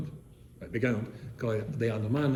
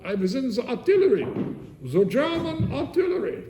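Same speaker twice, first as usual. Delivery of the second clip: put-on slow, high old German-accented voice, v for w, z for th; uncle's thick German accent telling the boys about his wars; 10 semitones higher, slower than usual. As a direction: imitate the elderly German refugee uncle. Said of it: "He talked with a German accent like this".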